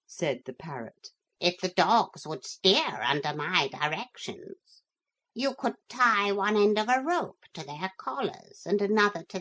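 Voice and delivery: brisk advising parrot. Acted. Parrot suggests steering at night, brisk and bird-like.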